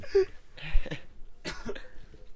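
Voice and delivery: harsh unvoiced coughs; a dry cough fit; real coughing. Real, not acted. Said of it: "You cough and making me cough".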